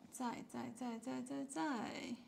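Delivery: 在 repeated quietly, each with a falling tone; teacher quietly drilling the falling 在; soft drilled tone. Shown as a demonstration. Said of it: "falling tone".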